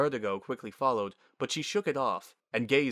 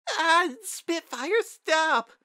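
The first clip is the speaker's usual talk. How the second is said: put-on high, loud kid's squeal; drawn-out squeaky complaint; protesting child; 6 dB louder, much slower than usual. A narrator's reading. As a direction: squeal in protest while being pinned. Child being tackled by her sister.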